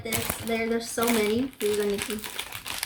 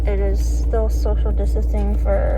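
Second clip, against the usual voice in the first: muffled by a mask; 10 dB louder than usual. Real, not acted. Masked in the store, recording.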